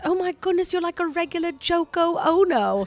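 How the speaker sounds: high-pitched mimicked English lady; high, put-on British lady voice. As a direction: imitate an amused English woman, pitched well up. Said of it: "[name]'s sister says".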